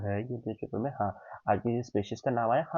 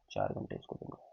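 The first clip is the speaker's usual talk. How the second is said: soft repeat; 13 semitones lower, 9 dB quieter, faster than usual. Real, not acted. Quiet repeat of the point.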